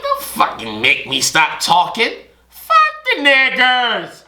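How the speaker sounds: a hint of a furious woman; lightly voiced ranting girlfriend; mock angry woman. Girlfriend yelling, acted.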